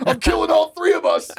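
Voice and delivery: very high, hammed-up maniac yell; crazed mock shout. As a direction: shriek the threat in play, laughing. Acted crazed threat while driving.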